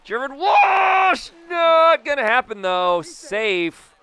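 very high, loud play-by-play burst; excited shouting. Real, not acted. Player cheering his hit past the fielder, shouting. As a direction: yell it high, easing a little at the end. Shouts as the ball gets through.

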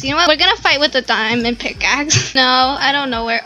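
usual speaking voice

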